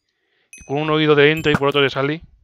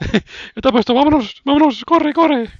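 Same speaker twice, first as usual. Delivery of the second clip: urgent; 11 semitones higher, 6 dB louder than usual. Real, not acted. Fleeing in game, urgent.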